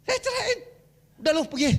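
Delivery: high-pitched mock quarrel, half laughing; retold angry outburst. Preacher re-enacts his quarrel with his fiancée, mock angry. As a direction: throw the partner out in a hammed-up high voice.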